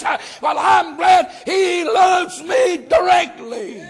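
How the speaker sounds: fired-up Southern pulpit shout; full-force preaching. Real, not acted. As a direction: preach it out to the whole church.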